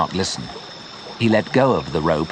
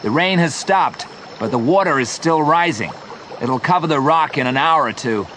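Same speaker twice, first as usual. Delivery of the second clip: restrained raised voice, higher pitch; shout; 5 semitones higher than usual. A narrator's reading. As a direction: call out a warning without full force. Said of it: "Joe shouted".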